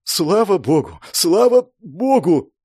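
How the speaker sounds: gasping relief, rising on the repeat; breathless relief. A narrator's reading. Rescued hero thanking heaven, breathless relief. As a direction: pant it out, overwhelmed. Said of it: "Тяжело задышал я от облегчения".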